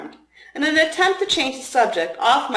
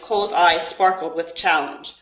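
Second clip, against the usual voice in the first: ordinary delivery, nothing marked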